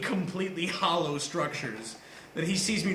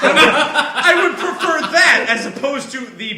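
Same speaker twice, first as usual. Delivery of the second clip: louder, high, laughing through it; laughing while talking; 5 semitones higher, 10 dB louder than usual. Real, not acted. Laughing as he answers the knife offer. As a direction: talk through the giggles.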